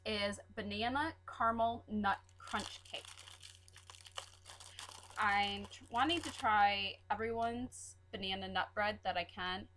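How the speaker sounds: ordinary talk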